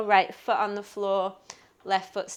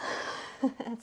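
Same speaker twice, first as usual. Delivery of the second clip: soft laugh; 3 semitones higher, 9 dB quieter than usual. Real, not acted. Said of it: "I'm laughing because that was really intense".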